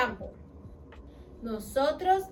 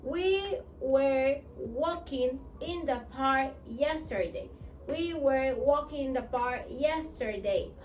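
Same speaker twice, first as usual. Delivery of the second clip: slow, word-by-word "We were walking in the park yesterday."; slow model sentence; slower than usual. Shown as a demonstration. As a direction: model it slowly for learners. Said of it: "Nosotros estábamos caminando". (frase modelo lenta)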